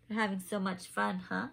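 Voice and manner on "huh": ordinary delivery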